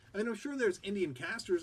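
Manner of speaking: ordinary delivery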